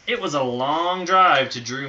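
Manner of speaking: ordinary delivery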